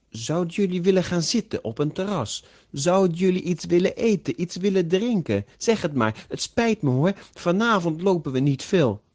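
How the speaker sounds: anxious to please. A narrator's reading. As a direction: fall over yourself making amends, pitched high. Giacomo anxiously makes amends.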